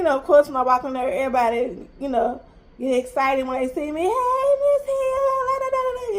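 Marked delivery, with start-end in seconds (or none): 4.0-6.2 high, sing-song hello from the school staff; cheery staff greeting. Retold or imitated. Staff greeting the mom at the door, high and sing-song. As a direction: mimic everyone perking up to greet her.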